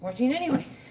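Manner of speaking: out of breath. Real, not acted. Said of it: "Let me catch my breath".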